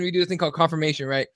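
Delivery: usual speaking voice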